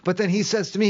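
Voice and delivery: ordinary talk, nothing marked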